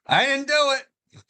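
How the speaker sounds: mock innocent denial. Mock guilty denial quote.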